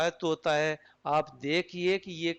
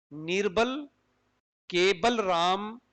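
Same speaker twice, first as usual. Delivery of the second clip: slow निर्बल, long pause, then cable राम; drawn-out cable राम set apart from निर्बल; two separate names; 2 semitones higher, slower than usual. Shown as a demonstration. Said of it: "निर्बल और cable राम".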